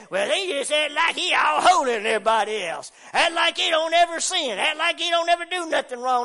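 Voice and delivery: a hint of a scornful critic; mock accuser. Mocking accusers of the faithful.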